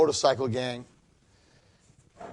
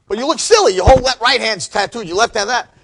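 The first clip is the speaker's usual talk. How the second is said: loud, higher taunting gang voice; mocking bikers; 8 semitones higher, 11 dB louder than usual. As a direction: needle him like rowdy bikers, loud.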